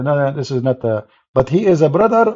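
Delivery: ordinary talk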